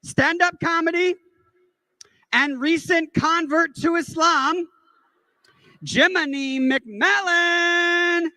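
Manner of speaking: high, slow, hyped-up stage introduction; event announcer. Retold or imitated. Host introduces comedian to the crowd, mock announcer hype. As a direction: imitate an MC, dragging out the name. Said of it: "he's introducing me, and he goes".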